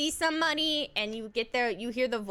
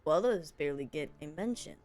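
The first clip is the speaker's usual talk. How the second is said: low narrator voice; 10 semitones lower, 5 dB quieter than usual. Acted. Reads game text in low voice.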